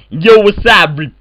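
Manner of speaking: ordinary delivery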